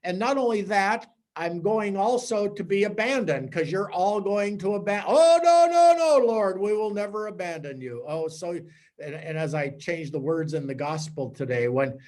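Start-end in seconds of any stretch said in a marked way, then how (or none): 5.1-8.0 high, loud cry of protest; an alarmed disciple insisting he will stay; pleading protest. Acted. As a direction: cry out in horror at being doubted. Disciples protesting loyalty to Jesus.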